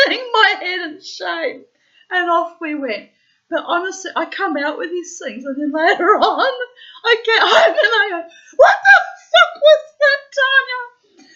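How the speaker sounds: high loud laughing self-rebuke; laughing scolding. Real, not acted. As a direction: scold herself while cracking up. Laughing, retelling her self-scolding.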